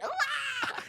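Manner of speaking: high, soft laughing squeal; embarrassed high squeal. Retold or imitated. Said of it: "see me go like this".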